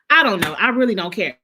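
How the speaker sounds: usual speaking voice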